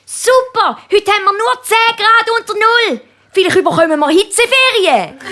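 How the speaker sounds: little penguin cheering about the heat; penguin child. Acted. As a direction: chirp it like a delighted child. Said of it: "Zwei Pinguinkinder unterhalten sich".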